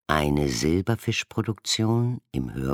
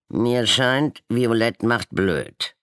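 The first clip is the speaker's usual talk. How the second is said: higher, louder croaking jab; croaking insult; 8 semitones higher, 5 dB louder, slower than usual. A narrator's reading. Golden raven croaking an insult at the violet one. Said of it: "krächzte ein Goldgelber".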